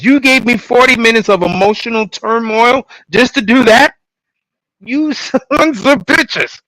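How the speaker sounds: high, loud mock outraged rant at the writers; mock outrage. Fan ranting at the show's writers for killing characters, mock fury. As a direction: rant at the show in exaggerated fury. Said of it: "I'm like".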